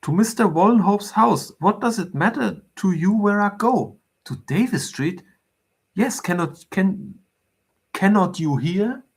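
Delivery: high-pitched, exasperated lady; indignant, impatient insistence. A narrator's reading. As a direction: demand it impatiently, pitch lifted. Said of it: "tapping the front impatiently".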